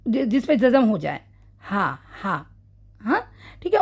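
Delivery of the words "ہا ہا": ہا said low and soft with air escaping; breathy ha demo. Shown as a demonstration. Teacher showing how the ہا sounds. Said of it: "کیسا ہا؟".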